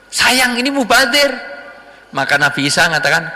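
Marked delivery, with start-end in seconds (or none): 0.1-1.3 high-pitched lament over waste; mock regretful outcry; acted dismay. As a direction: exclaim at what a pity it is. Acted dismay at wasted dead goat.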